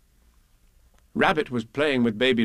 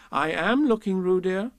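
higher, firm mother's reproof; rather severe; 7 semitones higher than usual. A narrator's reading. Kanga correcting little Roo, rather severe. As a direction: answer a child firmly. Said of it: "said Kanga rather severely".

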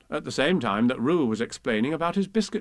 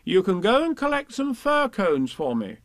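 higher gentle mother voice; motherly Kanga; 7 semitones higher than usual. Acted. Kanga sends them for fir cones, higher motherly voice. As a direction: give a kind little errand.

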